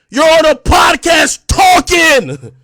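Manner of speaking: loud, high, drawn-out jeer; angry taunt. Real, not acted. Streamer calling out a podcast talker, angry taunt. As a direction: shout it slowly as an accusation.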